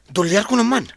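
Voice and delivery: excited boast. Acted. Friend bragging about his dollar winnings, loud and excited. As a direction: crow about the winnings loudly.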